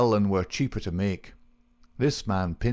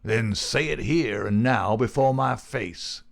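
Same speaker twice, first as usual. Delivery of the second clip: slow, raised challenge; defiant cry; 4 semitones higher, 4 dB louder than usual. Acted. Newcomer challenges his rival, crying out defiantly. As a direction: dare him to say it openly. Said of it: "cried McMurdo".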